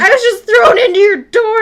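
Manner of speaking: high, loud mock wail; imitated crying girl. Crying girl explains herself to neighbor, imitated loud tearful wail. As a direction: blurt it like a distraught girl in tears. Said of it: "there's this girl crying".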